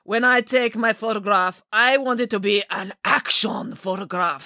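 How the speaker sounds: a showy photographer holding court, unhurried; Boris voiced.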